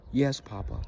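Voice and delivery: small, soft and meek; timid reply. Retold or imitated. Boy answering his angry grandfather, softly and meekly. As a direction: answer quietly, cowed and ashamed.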